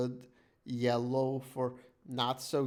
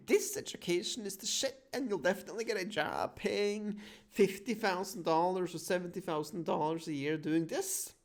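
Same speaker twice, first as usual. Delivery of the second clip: higher-pitched mock pitch for a degree; hyped-up sales promise; 6 semitones higher than usual. Voicing what the education guide promises students, in a high, hyped-up pitch. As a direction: oversell it like an education advert. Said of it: "even though it says that".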